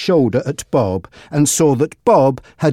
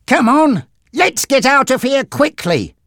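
urgent call; 10 semitones higher than usual. Acted. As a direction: rush them out the door. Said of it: "Frankie told Monty".

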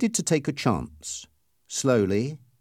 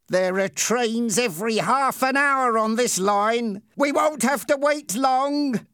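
slow, high-pitched gloating voice; drawn-out, rising taunt; cruel Ernie, taunting; 10 semitones higher than usual. Acted. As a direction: relish the victim's danger. Ernie taunts the tied boy.

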